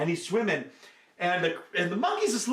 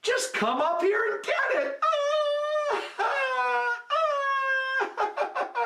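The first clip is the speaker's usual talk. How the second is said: very high, gleeful jeering laugh; mocking laugh; much slower than usual. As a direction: cackle down at the fooled crocodile. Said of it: "And the monkey's laughing".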